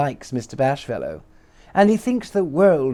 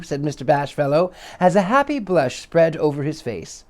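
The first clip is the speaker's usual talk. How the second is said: ordinary delivery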